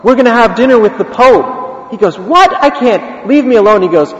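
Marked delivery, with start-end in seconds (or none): 0.0-1.9 higher-pitched, eager retold announcement; excited insistence. Priest repeats the big news. 2.2-3.8 high-pitched, incredulous protest; flustered refusal. Retold or imitated. Beggar panics at invitation.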